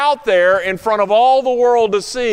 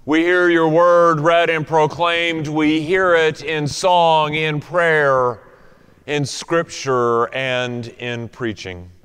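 low, slowing prayer voice; prayer from the pulpit; pastoral prayer; 5 semitones lower than usual. Acted. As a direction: pray it on behalf of the people, unhurried. Leads congregation in prayer.

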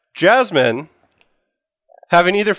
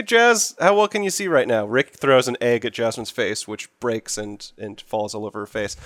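ordinary delivery

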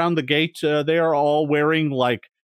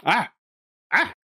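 ordinary talk, nothing marked

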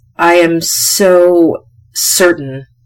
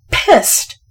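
pissed hissed out high and breathless; hissed anger; 9 semitones higher than usual. Real, not acted. Host fuming over her lost episode, hissed angrily. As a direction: spit the word out in real annoyance. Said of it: "'cause I'm pissed".

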